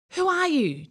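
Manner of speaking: high, loud startled cry. Acted. Boy cries out at beast across the fire. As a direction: call out in alarm at the beast. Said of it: "John cried".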